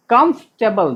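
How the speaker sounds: कंफर्टेबल in two parts, "KUMF - tebl"; correct "comfortable" pronunciation. Shown as a demonstration. Teacher asking students to note it, "kumf-tebl". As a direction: say कंफर्टेबल without o, a or r. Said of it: "ध्यान रखिएगा".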